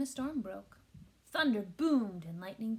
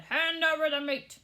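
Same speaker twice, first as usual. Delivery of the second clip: louder, higher gruff demand; pirate demand; 5 semitones higher, 7 dB louder than usual. A narrator's reading. Captain demands meat, mild pirate bark. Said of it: "Braidbeard gulped his food and said".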